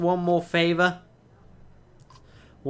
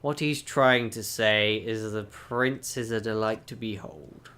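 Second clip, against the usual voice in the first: ordinary talk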